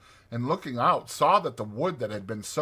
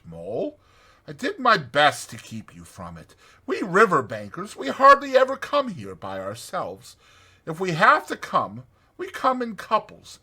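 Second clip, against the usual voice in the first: higher, louder voice of the sensible Rat; Rat, kindly lecturing; 7 semitones higher, 5 dB louder than usual. A narrator's reading. Rat explaining the wood's dangers to Mole, kindly. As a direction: gently scold the friend who strayed. Rat's character voice.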